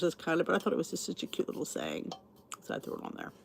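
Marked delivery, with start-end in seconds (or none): 2.6-3.3 whispering. Real, not acted. Aside repeated under breath.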